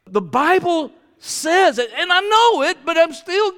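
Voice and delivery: full-force sermon; fiery preaching. Acted. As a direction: preach it out with fire.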